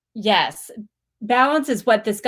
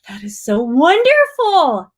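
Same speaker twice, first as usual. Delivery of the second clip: gushing praise; 6 semitones higher, 4 dB louder, slower than usual. Real, not acted. Praising a viewer.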